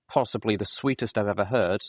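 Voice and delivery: usual speaking voice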